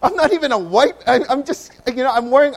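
high, loud startled outburst; a junior told he'll be captain, shocked; shocked disbelief. Retold or imitated. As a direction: burst out in disbelief at the news. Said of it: "I was like".

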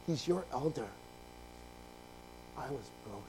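ordinary delivery

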